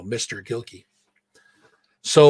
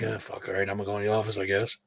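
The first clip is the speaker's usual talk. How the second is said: grudging surrender; 5 semitones lower, 10 dB quieter, faster than usual. Retold or imitated. Giving in to aides.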